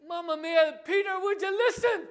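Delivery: loud, high mock-Italian outburst; exasperated Italian. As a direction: shout it like an exasperated, hammed-up Italian. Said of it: "like a good Italian and go".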